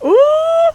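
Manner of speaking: long, high-pitched ooh; high teasing "ooh". Real, not acted. Streamer watching a girl fight brew, high teasing ooh. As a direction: hoot at the drama. Reacts to sassy game quarrel.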